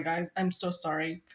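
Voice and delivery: usual speaking voice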